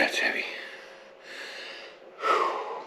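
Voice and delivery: ordinary delivery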